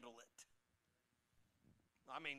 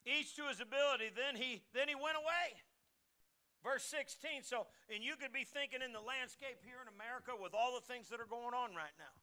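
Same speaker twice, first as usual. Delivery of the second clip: raised, louder pulpit voice reading scripture; preaching; 6 semitones higher, 4 dB louder than usual. Acted. Pastor reading the parable aloud to his church, raised. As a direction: proclaim it to the congregation.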